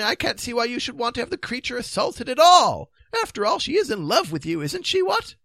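high, fretful protests; dithering king. Acted. King objecting to assaulting the beast, high and fretful. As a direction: fuss in a high, worried king's voice. Narrator voices the fretting king.

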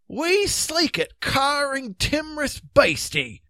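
high, slow Scots voice; Scottish drawbridge man. A narrator's reading. Drawbridge man greeting the fugitives, slow broad Scots. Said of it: "said the drawbridge man".

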